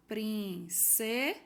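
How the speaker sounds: "Princesa" lento, com pausa entre as sílabas; sílabas separadas. Shown as a demonstration. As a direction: quebre "Princesa" em três sílabas. ("Princesa" silabado, bem devagar)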